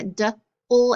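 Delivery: "d", pause, "ul"; sounded out in parts. Shown as a demonstration. Teacher showing class how the sounds blend, sounded out in parts. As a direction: split "d" from "ul" before blending.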